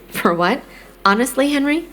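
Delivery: mother's incredulous rebuke, pitch rising; exasperated disbelief. A narrator's reading. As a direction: end high on a disbelieving question. Mother exasperated at son's question.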